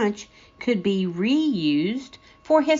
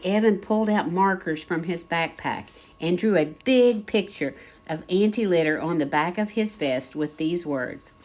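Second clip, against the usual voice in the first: ordinary delivery, nothing marked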